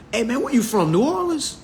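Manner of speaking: high-pitched imitated Louisiana accent. Asking where someone is from, Louisiana accent. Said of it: "how a person from Louisiana speak".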